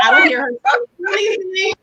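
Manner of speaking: rising panicked squeal; high panicked. Real, not acted. Panicking alone in stall.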